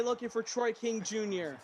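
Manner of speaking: ordinary talk, nothing marked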